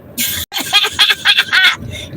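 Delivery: high shouted laugh; big laugh. Real, not acted. Laughing at the In Vogue quote.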